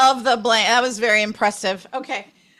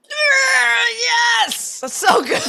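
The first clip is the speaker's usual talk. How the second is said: high, loud squealing yes with laughter; excited cheer; 12 semitones higher, 3 dB louder than usual. Real, not acted. Host celebrating teammate guessing the book, high delighted shout. Correct guess, Hitchhiker's Guide.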